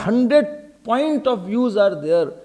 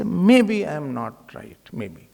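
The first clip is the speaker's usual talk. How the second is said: humble doubt; 6 semitones lower, slower than usual. Acted. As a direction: concede gently, letting the last word fade. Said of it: "less ahankar".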